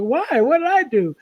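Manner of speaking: high, puzzled "Why? What did I do?"; retold surprise. Retelling her own puzzled reply.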